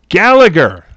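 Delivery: high, loud host introduction; projected roll-call voice; Bobby Van. Retold or imitated. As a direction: announce it like a game-show emcee. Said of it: "And when he introduces".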